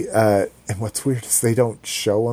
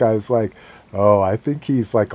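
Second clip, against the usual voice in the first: usual speaking voice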